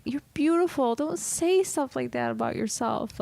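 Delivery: put-on high, slow, gushing teen voice; lightly voiced tearful teenager; emotional teen girl. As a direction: mimic a loopy teen pouring out feelings. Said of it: "really emotional".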